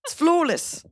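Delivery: put-on British accent. Host insisting on her accent, high exaggerated British accent. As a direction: say "flawless" in exaggerated British, vowel like "floo". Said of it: "this is a good accent".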